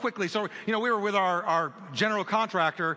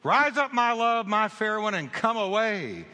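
ringing proclamation; 3 semitones higher than usual. Acted. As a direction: call it out to the whole church. Preacher shouts scripture to congregation.

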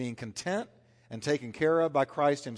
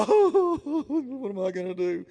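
mock panic; 12 semitones higher, 7 dB louder, slower than usual. Mock panic of losing a job.

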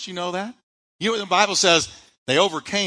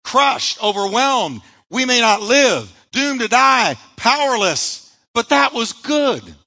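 higher, louder, each word hammered; build to a shout; 8 semitones higher, 7 dB louder, slower than usual. Shown as a demonstration. Pastor repeats Paul's words with rising, preaching fervor. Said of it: "can I say it again".

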